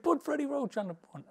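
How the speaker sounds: high pushy caller voice. Retold or imitated. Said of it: "He said".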